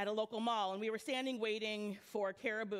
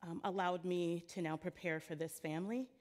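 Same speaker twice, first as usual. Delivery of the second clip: prayer; 4 semitones lower than usual. Real, not acted. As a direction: pray gently over the congregation. Opening prayer before sermon.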